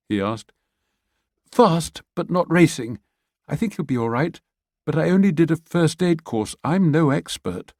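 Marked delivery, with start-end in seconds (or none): 1.5-7.7 higher woman's voice, unsure; Pia, worried. Acted. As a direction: answer quickly, a bit anxious. Pia checks the pulse.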